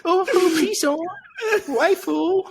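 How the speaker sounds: very high falsetto, whiny pleading; mock begging singer. Friend parodies the singer begging his girl, squeaky falsetto whine. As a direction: beg pathetically like a heartbroken crooner.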